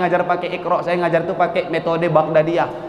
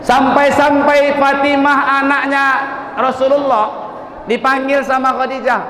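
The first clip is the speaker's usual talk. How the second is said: raised, slow emphatic sermon voice; fervent preaching; 7 semitones higher, slower than usual. Acted. Preacher extolling Khadijah to the congregation, fervent sermon. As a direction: lift the story up with fervour.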